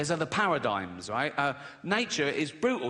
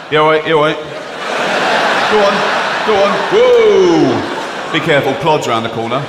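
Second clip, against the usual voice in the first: playing the lorry banksman; 16 dB louder than usual. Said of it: "But I can go".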